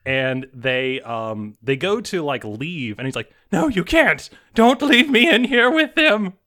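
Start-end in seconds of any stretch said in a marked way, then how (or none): 3.5-6.3 much higher, louder, slow pleading cry; desperate begging. Acted. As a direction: beg them not to leave you alone. Patient begs doctors not to leave.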